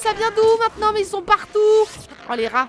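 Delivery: loud, high panicked shouts; panicked yelling. Real, not acted. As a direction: scream as rats swarm you. Ambushed by rats.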